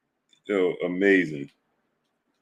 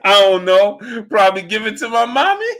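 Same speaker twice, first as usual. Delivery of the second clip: high mock Earl voice; 12 semitones higher, 4 dB louder than usual. Mocking Earl's answer about money.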